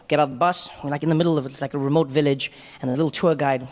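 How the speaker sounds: usual speaking voice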